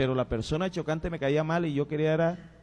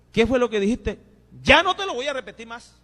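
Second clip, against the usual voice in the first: angry retort; 7 semitones higher, 6 dB louder than usual. Retold or imitated. The angry young man snapping back.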